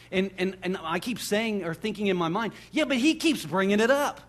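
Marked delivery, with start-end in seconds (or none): 2.7-4.2 high, louder comeback; insistent retort. Acted. Preacher answering his worry about overdoing God's love, insistent and high. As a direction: protest it with rising energy.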